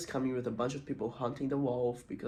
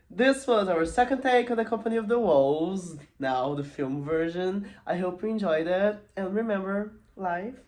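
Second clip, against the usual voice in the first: higher, projected presenter outro; show sign-off; 7 semitones higher, 7 dB louder than usual. Acted. Host closes the episode for viewers, higher announcer-like sign-off. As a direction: announce the closing with big lifted energy.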